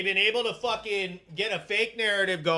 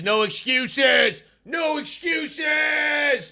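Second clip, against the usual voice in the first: mock shout; 5 semitones higher, 5 dB louder, much slower than usual. Retold viewer's rallying cry.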